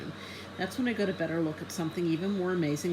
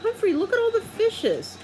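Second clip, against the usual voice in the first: put-on excited child; 4 dB louder than usual. A narrator's reading.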